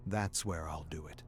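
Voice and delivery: ordinary talk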